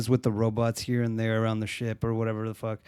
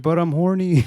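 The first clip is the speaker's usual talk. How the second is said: higher, louder whiny plea; mock whiny plea; 5 semitones higher, 6 dB louder than usual. Chris Pratt begging Jennifer Lawrence, mock whiny. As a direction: beg like a needy boyfriend.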